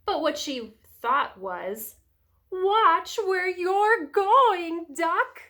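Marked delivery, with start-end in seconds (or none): 2.5-5.4 high, loud, very slow storybook warning; chicken's warning. A narrator's reading. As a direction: stretch out a scolding hen's warning. Chicken warns Duck in story.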